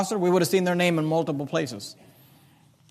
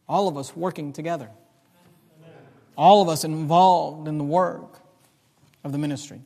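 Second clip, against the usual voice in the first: lower, softer, with weighty pauses; hushed, earnest preaching; 4 semitones lower, 4 dB quieter than usual. Acted. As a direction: let the key point land quietly. Hushed sermon point after loud build.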